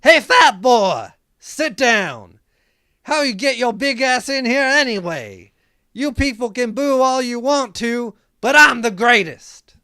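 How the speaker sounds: high, loud sneering heel promo; sneering wrestling villain. Acted. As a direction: taunt the crowd like a wrestling heel. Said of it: "I'm Ryan the Muffler Bruckner saying".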